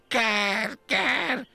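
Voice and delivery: high, harsh crow caw. A narrator's reading. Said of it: "károgta egy ágon fekete ruhában". (magas varjúkárogás)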